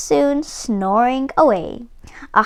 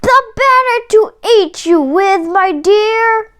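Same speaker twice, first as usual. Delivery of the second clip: loud, much higher pouncing wolf; hammed-up big bad wolf roar; wolf's cry; 10 semitones higher, 5 dB louder than usual. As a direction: bellow it as he leaps. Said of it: "cried the wolf".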